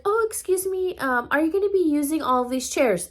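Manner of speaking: high, sweet-sounding polite request. Retold or imitated. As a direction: imitate a friend asking ever so nicely. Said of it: "very nicely".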